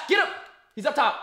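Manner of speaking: urgent yelled warning; shouting callouts. Real, not acted. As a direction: call out the enemy position loudly. Live firefight callouts.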